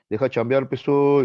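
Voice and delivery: smooth it over with the big passenger; changed tone. Retold or imitated. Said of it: "El cobrador cambió el tono".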